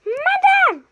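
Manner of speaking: high, loud call. Acted. Prisoner shouts for the guard, high and loud. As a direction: yell for the guard.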